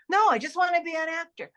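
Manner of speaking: put-on stubborn kid voice. Kid insisting on acting.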